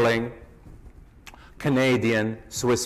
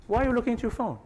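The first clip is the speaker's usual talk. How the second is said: pitched up high in mock disbelief, a bit softer; mock exasperation; 8 semitones higher, 4 dB quieter, much faster than usual. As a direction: tease the one glued to his phone. Teasing a distracted audience member.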